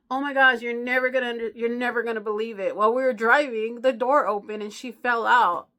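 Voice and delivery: loud, high, rushed lying; frantic excuse. Retold or imitated. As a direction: blurt the fake story loudly, panicking. Jerry lying frantically to husband.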